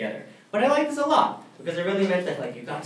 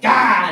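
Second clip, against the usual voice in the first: panicked yelp; 6 semitones higher, 12 dB louder than usual. Retold or imitated. Panicked at knife attack.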